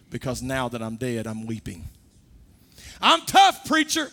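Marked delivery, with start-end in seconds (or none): 3.0-4.1 a hint of a cocky, boastful churchgoer, much higher and louder; mock tough guy. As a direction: throw the boast back mockingly. Mocking a proud churchgoer's boast.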